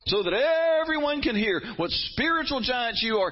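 high, impassioned denunciation; preaching at full force. Retold or imitated. Preacher voicing Jesus against public pray-ers, high impassioned rebuke. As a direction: rail against showy prayers. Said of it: "he says".

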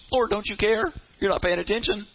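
high, plaintive complaint; frustrated. Acted. Drained believer complaining to the Lord, frustrated plea. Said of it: "you're so frustrated".

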